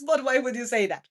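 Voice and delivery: ordinary talk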